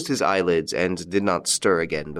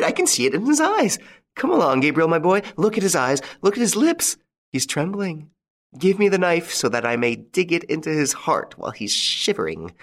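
frenzied widow; 10 semitones higher than usual. A narrator's reading. Widow urging Gabriel to bring the knife, frenzied. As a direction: revel in his fear, voice soaring.

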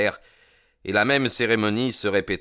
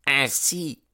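high-pitched angry character voice; indignant, pitched well up; furious; 8 semitones higher than usual. A narrator's reading. Accusing McMurdo before the boss, furious. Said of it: "en lançant un regard furieux".

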